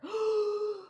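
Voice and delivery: sharp high gasp of shock; dramatic gasp. Real, not acted. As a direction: gasp as if scandalized. Shocked by reveal on show.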